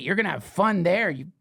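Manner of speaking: ordinary delivery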